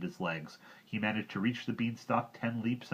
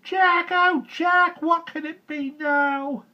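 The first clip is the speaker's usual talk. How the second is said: very high, slow fretting mother; slow high anxious cry; 13 dB louder, much slower than usual. A narrator's reading. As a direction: wail it like a worried mother.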